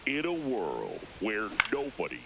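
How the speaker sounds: low, booming trailer narrator; deep dramatic movie trailer read. Pretend. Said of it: "cue the movie trailer voice".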